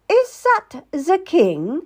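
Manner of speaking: slow, high, lightly sleepy queen; drawn-out, high drowsy question; hazy with sleep. A narrator's reading. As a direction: ask slowly, as if just waking. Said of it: "still hazy with sleep".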